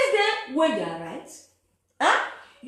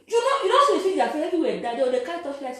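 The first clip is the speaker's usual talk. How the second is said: ordinary talk, nothing marked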